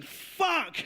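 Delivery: shop worker. Retold or imitated. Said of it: "as they're going".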